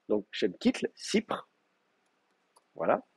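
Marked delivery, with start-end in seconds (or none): 0.9-1.5 Sipr soufflé, sans voix; forme primitive chuchotée. Shown as a demonstration. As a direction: murmurer Sipr comme forme théorique. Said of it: "ça devrait être Sifr". (Sipr, chuchoté)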